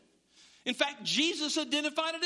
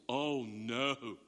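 low, soft, drawn-out mock horror; acted sinking realization; hushed dismay; 13 semitones lower, 3 dB quieter than usual. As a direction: sink into a quiet groan of dread. Repeats dismay at irreplaceable loss.

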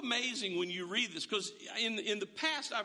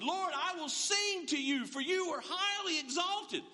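exultant praise; 8 semitones higher than usual. Acted. Quoting a song of praise.

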